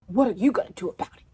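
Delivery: mock taunt from the boy; taunting challenge. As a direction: throw the dare back at her. Boy's taunting challenge retold.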